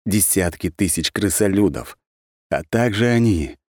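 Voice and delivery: usual speaking voice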